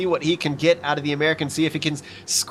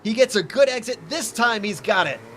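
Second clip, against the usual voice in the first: rising higher as the pass completes; excited race call; 6 semitones higher than usual. Real, not acted. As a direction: build the excitement into the overtake. Overtake completed live on air.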